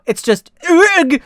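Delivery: overwhelmed with nerves; giddy groan. Acted. Friend admitting butterflies, giddy and high.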